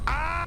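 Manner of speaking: sharp fright cry; sudden short scream; high startled yelp. Real, not acted. Startled in game.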